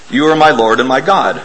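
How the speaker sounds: ordinary talk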